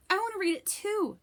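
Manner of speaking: put-on girl trying to impress Danny, high and eager. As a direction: chime in keenly to impress the boy. Rebecca competing for Danny.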